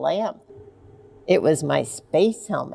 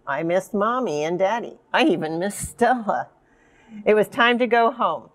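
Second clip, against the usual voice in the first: plain reading voice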